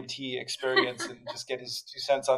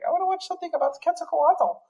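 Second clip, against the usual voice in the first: imitating his kid; faster than usual. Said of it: "he's like".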